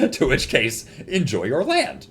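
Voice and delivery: high, loud mock scolding broken by laughs; gleeful loud ribbing, laughing between phrases; laughing taunt. Real, not acted. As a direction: taunt your friend while cracking up.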